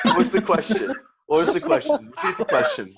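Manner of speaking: giggling demand to repeat; laughing. Real, not acted. Asking again while laughing.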